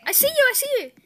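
high, loud gleeful yelling; excited shout. Real, not acted. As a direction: yell it out as you chase. Chasing player in game.

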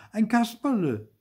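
high, loud correction; shouted protest. A narrator's reading. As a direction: cry out who you really are. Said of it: "schrie Zäpfel". (hoch und laut schreiend)

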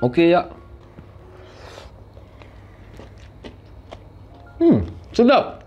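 speaking between bites; talking while chewing. Real, not acted. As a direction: mouth partly full of food. Seen 5: Food taken at -2.0; cheek bulges and jaw chews through the words.

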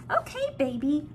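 ordinary talk, nothing marked

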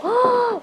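sharp high gasp of amazement; impressed gasp. Acted. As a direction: gasp as if wowed by the room. Gasps at the kitchen.

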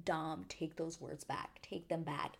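soft, slow begging; pleading softly. Retold or imitated. Vlogger pleading with Dom on screen, soft and slow. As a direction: plead under your breath. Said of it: "I was like".

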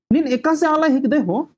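high-pitched acted scolding; annoyed. Karmu demands an answer from his companion, annoyed. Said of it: "गुस्सा होता है".

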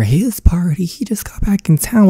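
high, breathless whispered gossip; shocked whispered aside. Acted. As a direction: lean in and whisper it. Whispered aside between characters.

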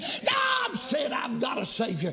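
rhythmic chanted preaching, rising to shouts; fiery revival preaching. Acted. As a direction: whoop the sermon over the organ. Preaching at full force with music.

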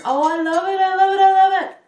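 high, loud squeal of delight; rising, repeated burst of joy, almost sung; real gleeful gushing. Real, not acted. Crocheter thrilled with her friend's gift, gleeful gushing.

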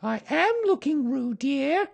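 high, firm mother kangaroo; high-pitched motherly reproof; rather severe. A narrator's reading. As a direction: answer the child severely in a high voice. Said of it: "said Kanga rather severely".

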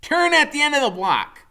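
very high, loud direction; a boy rider steering; Freak's voice. A narrator's reading. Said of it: "he says".

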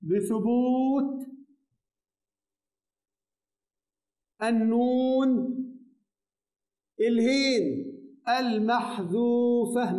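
بثبوت النون المحذوفة spaced out with long pauses; slow dictation. Shown as a demonstration. Teacher dictating the grammatical ending to students. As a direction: dictate the parsing phrase slowly. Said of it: "مرفوع بإيه يا شباب؟".

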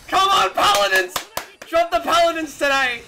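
excited fan hollering demands; hyped shouting. Real, not acted. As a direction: yell at them to deliver. Shouting at game reveal stream.

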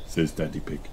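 plain reading voice

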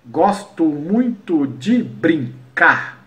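very slow, syllable by syllable; syllable counting. Shown as a demonstration. Teacher scanning another example verse, slow syllable-by-syllable count. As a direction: beat out each syllable up to the last stress. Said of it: "se eu pegar uma outra estrofe".

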